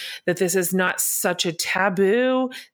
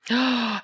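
quick real intake of breath; surprised gasp; 4 semitones higher than usual. Real, not acted. Host hears of the affirming church, surprised gasp. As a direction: gasp at an unexpected turn.

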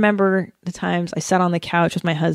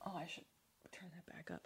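whisper; 34 dB quieter, faster than usual. Real, not acted. Aside while fixing audio.

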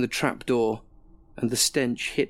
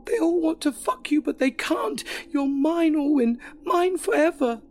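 raving shriek. Acted. Alison shrieking at her boyfriend about the guests, possessive high shriek. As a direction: shriek it like a deranged lover.

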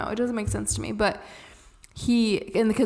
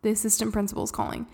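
normal talking voice

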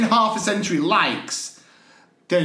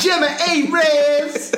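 high, slow drawn-out laughing name; laughing shout; 9 semitones higher, 4 dB louder, much slower than usual. Acted. Repeating the name mockingly.

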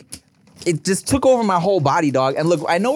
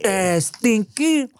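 slow, high mock French lilt; French accent; 7 semitones higher, much slower than usual. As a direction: drawl it like a snooty French chef. Echoes partner's French bit.

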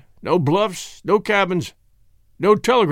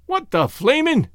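startled; 8 semitones higher, slower than usual. A narrator's reading. Startled exclamation.

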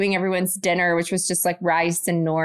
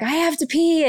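Esme whining; 8 semitones higher than usual. Retold or imitated. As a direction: play the urgent small child. Mum voicing her daughter Esme.

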